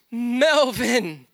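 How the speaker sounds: high, loud call of a name; retold joyful shout. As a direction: shout it out to a long-lost friend.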